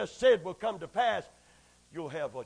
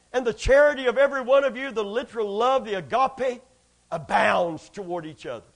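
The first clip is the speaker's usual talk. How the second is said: preached scripture reading; 10 dB louder than usual. Acted.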